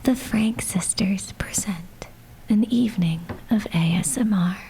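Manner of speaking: soft whispered reading; ASMR whisper. Acted. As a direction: read it as an ASMR host. Reading ASMR intro again.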